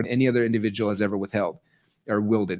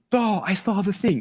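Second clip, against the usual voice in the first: slow mock awe; 8 semitones higher, slower than usual. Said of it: "be like".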